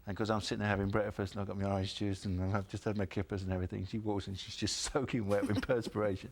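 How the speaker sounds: ordinary talk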